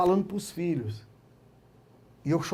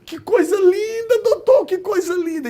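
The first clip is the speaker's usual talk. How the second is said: moved judge; 11 semitones higher, 9 dB louder than usual. Retold or imitated. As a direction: echo the judge's tearful wonder.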